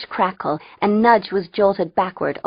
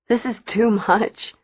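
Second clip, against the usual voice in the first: slow, higher wheeze from laughing; wheezing; 4 semitones higher than usual. A narrator's reading. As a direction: wheeze it out slowly. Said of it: "Fang wheezed".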